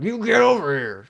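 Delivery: high, loud order; commanding shout. Retold or imitated. Old customer ordering the clerk over, loudly. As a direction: bark the command at him. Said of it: "And he was like".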